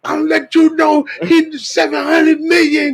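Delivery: high, crying-out yell; wailing stranger. Retold or imitated. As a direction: howl it like a desperate stranger. Voicing the beggar crying out.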